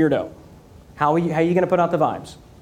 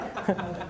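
real laugh; 12 dB quieter than usual. Real, not acted. Laughs after joke.

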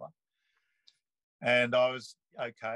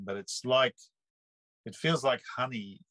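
ordinary talk

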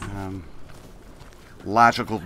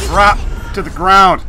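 loud, high urgent shout; 7 semitones higher, 10 dB louder than usual. Real, not acted. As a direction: bark the order in panic. Yelling during combat.

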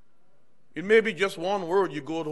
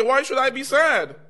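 high, loud comeback; loud retort; 9 semitones higher, 10 dB louder than usual. Retold or imitated. Peter answers his coworker, high and loud. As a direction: fire the question back with conviction.

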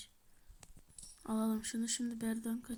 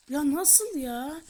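stunned disbelief; 3 semitones higher, 7 dB louder, much slower than usual. Real, not acted. Stunned by game failure.

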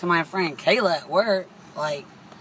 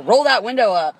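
loud, higher bossy command; mock bossy order; 6 semitones higher, 8 dB louder than usual. Imitating the driver ordering her window up, bossy. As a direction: snap it like her. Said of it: "She's like".

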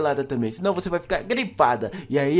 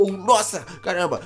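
ordinary talk, nothing marked

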